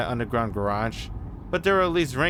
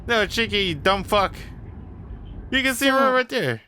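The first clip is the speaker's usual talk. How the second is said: exasperated outburst; 8 semitones higher than usual. Real, not acted. Exasperated outburst at Cheeky during the game.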